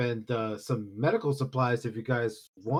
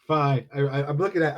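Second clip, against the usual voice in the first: ordinary talk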